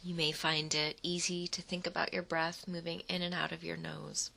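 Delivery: usual speaking voice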